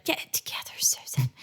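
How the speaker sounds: whispered aside to herself; hushed, breathy whisper. Retold or imitated. Self-talk aside, whispered.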